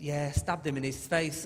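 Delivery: slow, casual menace overheard; understated sinister phone chat. Retold or imitated. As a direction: finish a dark chat slowly and matter-of-factly. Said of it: "Sometimes a little bit sinister".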